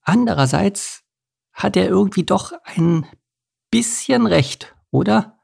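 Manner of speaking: high, hesitant bird voice; small, tentative thrush admitting it; sheepish. A narrator's reading. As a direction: concede it meekly, drawing out the end. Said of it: "meinte etwas kleinlauter".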